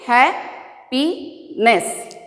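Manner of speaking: "Happiness" very slowly in three separate parts; broken into syllables. Shown as a demonstration. Teacher answering how to break it, "Happiness" syllable by syllable. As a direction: leave a long gap between the beats of "Happiness". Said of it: "How can we break it?".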